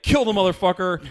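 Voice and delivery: loud mock yell of a fan at the TV; retold yelling fans. Fans screaming at the TV, loud mock yell. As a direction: yell it like a fan losing it at the screen. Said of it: "all these guys are screaming at the fucking T V".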